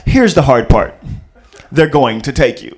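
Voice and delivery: low, loud, slow stern father; deep deadpan movie dad. Acted. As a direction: lay down the hard news like a grim dad. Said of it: "They want, uh".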